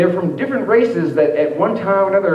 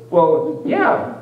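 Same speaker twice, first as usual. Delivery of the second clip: put-on girl's reply; 9 semitones higher, much slower than usual. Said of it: "she goes".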